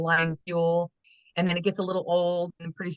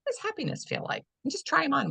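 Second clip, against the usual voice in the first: playful high voice; 10 semitones higher, 3 dB quieter than usual. Real, not acted. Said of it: "playful closet imagery".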